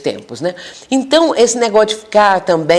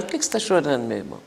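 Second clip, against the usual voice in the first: much softer, tender asking; soft gentle question; 4 semitones lower, 11 dB quieter, much faster than usual. Shown as a demonstration. Counselor quietly asking a crying spirit why, very soft and tender. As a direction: ask it quietly, like comforting someone. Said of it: "o outro que chega chorando".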